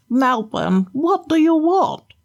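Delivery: lightly voiced enchanted fish, pleading; a gentle put-on fish prince; talking flounder. Flounder begging the fisherman to let him go.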